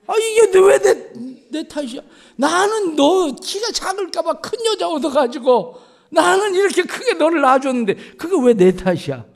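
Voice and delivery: father stunned when his son blames him; indignant shock. Retold or imitated. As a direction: fling it back, wounded and outraged.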